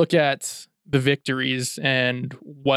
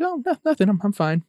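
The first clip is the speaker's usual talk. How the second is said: wife's voice; 7 semitones higher, faster than usual. Retold or imitated. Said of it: "She's like".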